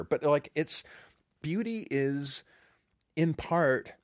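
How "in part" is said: normal talking voice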